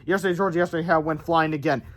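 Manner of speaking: ordinary delivery, nothing marked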